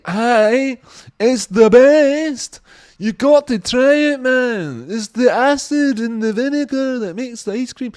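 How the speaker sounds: put-on very high, loud, slow drunken insistence; drunk guy voice. Mimicking a drunk praising pickled shrimp and ice cream. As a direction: ham up a jakey swearing it's the best. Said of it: "It's like".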